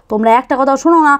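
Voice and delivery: ordinary talk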